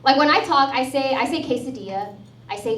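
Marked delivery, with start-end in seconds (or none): 1.4-2.1 "quesadilla" said in plain American English; unaccented Spanish word. Shown as a demonstration. Comic showing the crowd she has no accent, "quesadilla" in plain American. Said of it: "I don't have an accent when I speak".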